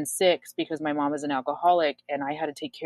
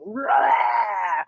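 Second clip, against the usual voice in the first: loud, high imitation roar; raised, cartoonish "rah" outburst; mock boyfriend roar; 7 semitones higher, 5 dB louder than usual. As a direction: blurt a wordless yell, mimicking the boyfriend. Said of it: "her boyfriend was like".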